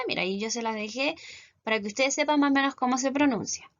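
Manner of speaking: ordinary talk, nothing marked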